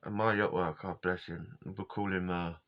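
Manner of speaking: ordinary talk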